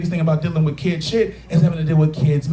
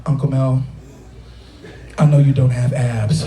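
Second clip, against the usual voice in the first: put-on deadpan child; 6 semitones lower than usual. Goddaughter bluntly tells her uncle, low deadpan. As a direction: deliver it like a blunt little judge.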